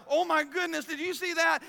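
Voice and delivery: high, loud gush of amazement; exclaiming. Retold or imitated. Preacher mimicking people's careless exclamations, excited and loud. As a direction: imitate someone blurting out an excited exclamation.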